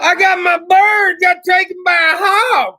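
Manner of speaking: loud, high mock lament; hammed-up shout of loss; mock outcry. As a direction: wail it like a tragedy. Mock wail over bird story.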